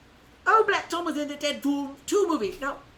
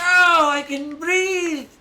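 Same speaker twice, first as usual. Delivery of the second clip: loud, high, drawn-out relieved cry; toy gasping free; 6 semitones higher, 12 dB louder, much slower than usual. Pretend. Freeing the toy from his box, loud mock relief. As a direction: burst out like someone finally freed from a box.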